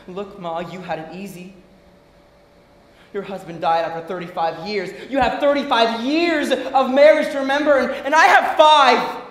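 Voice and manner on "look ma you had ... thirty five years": low, hushed reproach; restrained resentment. Acted. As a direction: pull the anger down to a low, tight quiet. Acted quarrel, reining it in.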